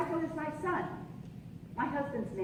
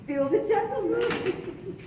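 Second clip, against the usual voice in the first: slow and giddy with a laugh; charmed laughter; 5 semitones higher, 6 dB louder, slower than usual. Acted. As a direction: laugh through the words. Reunion, laughing together.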